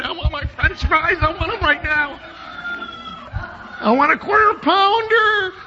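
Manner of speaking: high-pitched hammed-up kid throwing a fit; whining child tantrum. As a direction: wail it like a demanding child. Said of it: "as free to express ourselves as kids are".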